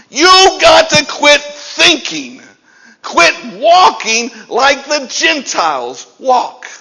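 loud, high, urgent preaching; emphatic demand. Acted. Preacher voicing Paul urging Gentile believers to change, emphatic. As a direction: insist they hear every word, punching it hard. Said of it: "This is a very emphatic statement".

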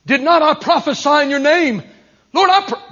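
loud, high protest to God; frantic excuse-making. Acted. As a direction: plead your case at the top of your voice. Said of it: "they start making excuses to God".

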